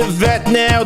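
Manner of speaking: ordinary talk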